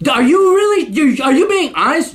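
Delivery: ordinary talk, nothing marked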